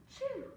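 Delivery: gasp, then high "choo"; mimed sneeze. A narrator's reading. Storyteller as the dog catching cold, mimed high sneeze. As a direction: act out the dog's sneeze. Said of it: "and the sneeze".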